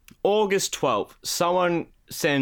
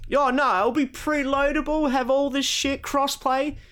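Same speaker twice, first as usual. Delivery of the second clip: much higher, cocky hype voice; mock Randy bragging; 11 semitones higher than usual. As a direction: oversell it like a showman.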